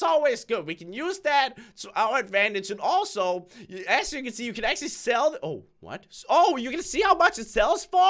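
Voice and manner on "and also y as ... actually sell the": ordinary talk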